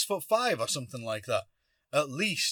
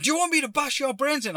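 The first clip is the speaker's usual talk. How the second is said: high-pitched mock threatening yell; imitated threat; 12 semitones higher than usual. Stranger coming up to the driver, imitated high threat. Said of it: "he started coming up to me and said".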